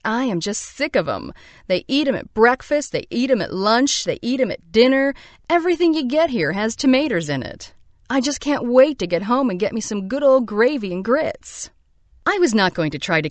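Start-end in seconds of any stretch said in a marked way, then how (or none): 0.0-11.7 higher, faster, complaining Southern belle; Southern belle accent. Retold or imitated. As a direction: gripe like an Atlanta belle sick of tomatoes. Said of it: "In her accent, thick as sweet tea".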